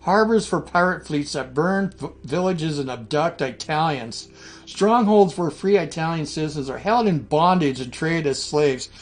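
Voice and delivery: roman orator. Acted. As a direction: declaim it like a Senate decree. Reading Rome's war declaration aloud.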